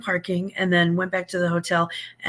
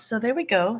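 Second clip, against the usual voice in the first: usual speaking voice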